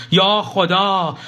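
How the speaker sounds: sudden high drawn-out yell of alarm; shouted cry. A narrator's reading. Husband yells in alarm at an oncoming car, high and drawn out. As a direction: cry out to God as the car swerves. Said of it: "فریاد زد".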